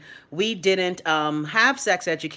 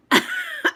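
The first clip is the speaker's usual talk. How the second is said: sudden high-pitched laugh; high burst of laughter. Real, not acted. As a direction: let out a high squeal of a laugh. Laughs at phone overheating.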